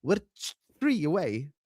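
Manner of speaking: light Irish accent imitation; lightly mimicked Irish accent. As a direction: have a go at her Irish "three". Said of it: "'Cause she's Irish".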